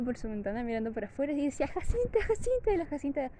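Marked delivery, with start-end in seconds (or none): 1.7-2.7 high, carrying call out the window; mother calling her dog from the window. Retold or imitated. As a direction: call the dog's name across the yard, pitched high.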